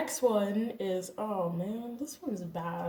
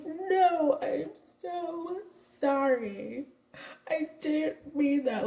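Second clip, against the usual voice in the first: slow, higher, pleading mock sob; retold tearful apology; 7 semitones higher, much slower than usual. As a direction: plead like you're about to cry, but only hinting it. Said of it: "I literally started bawling".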